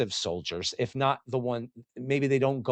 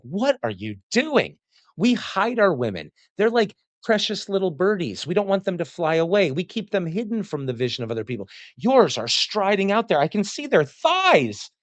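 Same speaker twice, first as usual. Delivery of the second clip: higher, shocked outsider voice; scandalized onlooker; 6 semitones higher than usual. Retold or imitated. Greek onlooker gaping at the Spartans, scandalized and higher. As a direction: play a Greek aghast at Spartan women. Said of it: "looking at the Spartans like".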